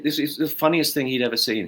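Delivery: ordinary talk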